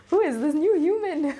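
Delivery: high squealing laugh through the words; laughing delight. Real, not acted. Telling viewers about her new calm, laughing.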